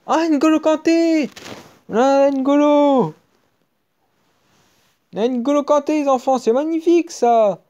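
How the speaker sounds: very high, loud cries of joy; real overjoyed pack-opening screams; ecstatic. Real, not acted. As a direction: shriek with delight at the pull. Pulls star player N'Golo Kanté.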